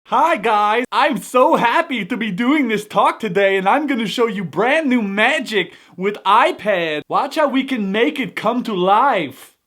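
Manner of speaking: magician impression. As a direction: present it like a keynote magician, slowly. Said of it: "Most impersonations of magicians".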